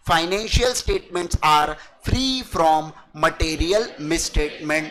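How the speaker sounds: very slow, word by word; slow dictation. Shown as a demonstration. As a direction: dictate it for students to write. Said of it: "मतलब क्या?". (छात्रों को धीरे-धीरे लिखवाना)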